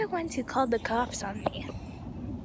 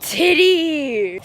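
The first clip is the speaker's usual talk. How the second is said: loud drawn-out call, falling at the end; 10 dB louder than usual. Real, not acted. Vlogger spots Titty over there, calling out loudly. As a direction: holler the pet's name across the yard.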